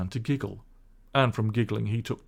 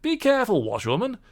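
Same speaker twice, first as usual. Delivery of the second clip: higher, louder warning gentleman; warning call; 11 semitones higher, 4 dB louder than usual. Acted. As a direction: warn her sharply. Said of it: "call out warningly".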